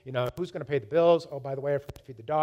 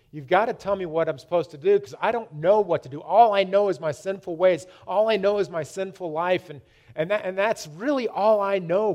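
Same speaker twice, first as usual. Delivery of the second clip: louder, higher, insistent pleading; retold desperate plea; 4 semitones higher, 5 dB louder than usual. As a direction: plead with God, building in force.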